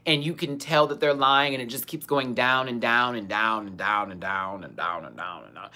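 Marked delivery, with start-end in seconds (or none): 2.3-5.7 spiralling downward. Shown as a demonstration. Storyteller showing how the lying keeps going, sinking lower and trailing away. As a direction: voice sliding lower and softer with each repeat. Acting out endlessly descending lies.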